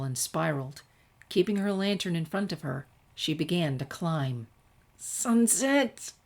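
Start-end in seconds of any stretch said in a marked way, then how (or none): 5.0-6.1 whiny drawn-out call; groaning. Acted. Twilight groans to Sunset while climbing, whiny. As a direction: groan the name from the stairs. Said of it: "came the groan".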